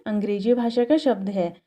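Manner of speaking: ordinary delivery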